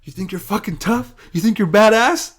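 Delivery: lightly voiced coach laying down a challenge; goading coach. Coach challenging his wrestler mid-final, mildly taunting. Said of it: "And my coach goes".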